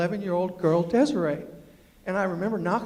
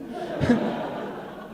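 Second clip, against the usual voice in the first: breathy laugh. Real, not acted. After promising the girl, a breathy laugh.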